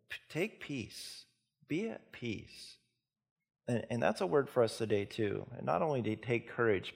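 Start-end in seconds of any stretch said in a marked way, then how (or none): 0.1-2.8 slow, higher and soft reassurance; slow hushed Jesus saying be at peace; gentle comfort. Acted. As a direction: soothe her slowly and softly.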